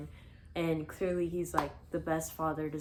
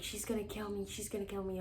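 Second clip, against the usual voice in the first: soft, hushed retold panic; retold scared; 2 semitones higher, 8 dB quieter, faster than usual. Teen hiding in closet from mom, soft retold fear. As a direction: repeat it under your voice, imitating dread. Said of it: "I'm scared".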